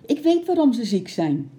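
high, loud announcement; excited child's high shout; excited cry. A narrator's reading. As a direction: blurt out your discovery loudly. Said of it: "riep ze opgewonden".

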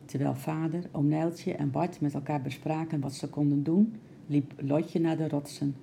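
ordinary delivery

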